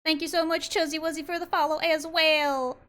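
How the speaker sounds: put-on 1 Southern accent. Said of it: "Southern accent redeem".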